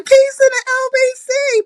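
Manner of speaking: called-out high signature greeting; big sing-song hello to viewers; loud greeting. Real, not acted. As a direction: shout the greeting to the chat.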